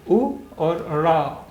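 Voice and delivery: उ और रा drawn out, each syllable held; slow syllable by syllable. Shown as a demonstration. Teacher spells out a word for pupils, very slowly. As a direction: sound out उ and रा very slowly. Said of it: "अब आप इसे पढ़िए".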